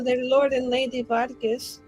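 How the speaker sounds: ordinary talk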